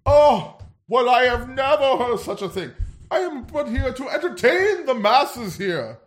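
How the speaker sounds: high, haughty British royal voice; snooty British prince to the rude wrestler; bad posh British accent. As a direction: play an offended English prince. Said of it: "I'm not doing a good accent".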